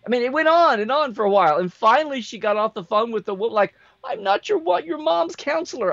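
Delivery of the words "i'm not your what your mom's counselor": high mock protest; high-pitched retold protest. Clerk to customer about her mom, high-pitched mock protest. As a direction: imitate a put-upon clerk, pitched way up.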